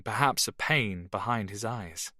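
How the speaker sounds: plain reading voice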